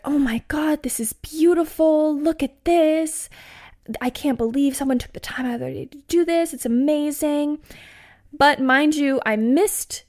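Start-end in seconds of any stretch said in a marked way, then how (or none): 0.1-7.6 higher-pitched imitation of a fascinated friend; Margot, gushing awe. Friend fascinated by little rock statues, imitated gushing. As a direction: gush over it like an awestruck friend. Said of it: "Margot was fascinated by them".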